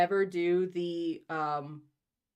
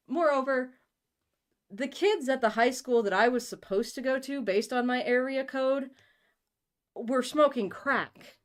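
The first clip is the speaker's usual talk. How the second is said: emphatic; 8 semitones higher, 6 dB louder than usual. Real, not acted. Dramatic buildup about crack.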